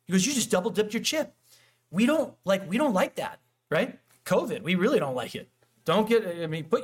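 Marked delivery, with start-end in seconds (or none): 0.3-1.3 put-on cop-like kid. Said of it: "the kid comes over like a cop".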